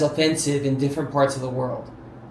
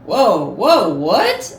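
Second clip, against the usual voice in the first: startled whoa; 3 semitones higher, 6 dB louder, much slower than usual. Retold or imitated. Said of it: "and I was like".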